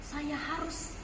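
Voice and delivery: a soft worried thought to herself; quiet inner thought. A narrator's reading. Mother deciding to seek Jesus, soft inner voice. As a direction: think it through quietly. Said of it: "ibu ini berpikir".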